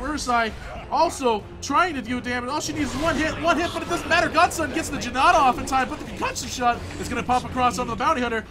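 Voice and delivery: high, loud screaming game call; excited play-by-play. Acted. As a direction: scream the fight like a caster. Live play-by-play in a teamfight.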